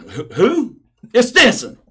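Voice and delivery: loud high booming imitation; Stinson's voice. Stinson repeats his name on the phone, booming imitation. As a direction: belt the name like a hard-talking linebacker. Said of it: "That's how he talks".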